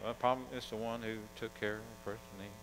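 quiet grudging answer from a proud lawyer; grudging mumble. Retold or imitated. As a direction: mumble it, unwilling to name him. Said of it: "They couldn't even say Samaritan".

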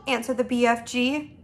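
plain reading voice

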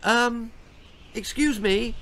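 high, timid apology; tiny apologetic voice. A narrator's reading. Timid queen interrupting the court, tiny voice. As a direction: squeak it from the back of the room. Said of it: "apologised a tiny voice".

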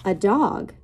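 lilting picture-book read-aloud; reading to children. Acted. Teacher reading a rhyming book to her class, lilting read-aloud. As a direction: read the rhyme to little kids, savoring each line.

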